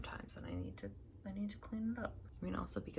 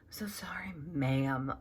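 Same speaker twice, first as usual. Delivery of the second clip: imitating an embarrassed man, whispered; mocking a sheepish apology in a whisper; 3 semitones higher than usual. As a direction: whisper the sorry like a sheepish guy caught cursing. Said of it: "it's like".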